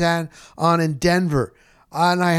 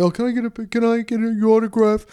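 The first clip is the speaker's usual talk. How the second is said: mock starstruck fan; 8 semitones higher, faster than usual. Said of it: "I never, never like".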